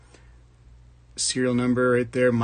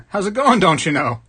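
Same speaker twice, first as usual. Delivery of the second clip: louder, higher hammed-up Wisconsin accent; 7 semitones higher, 7 dB louder than usual. Streamer teasing Michigan viewer in chat, mock Wisconsin accent. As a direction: put on a folksy Wisconsin accent. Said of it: "That's actually Wisconsin".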